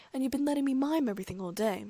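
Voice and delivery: high-pitched indignant complaint; angry. A narrator's reading. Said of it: "Fudge said angrily".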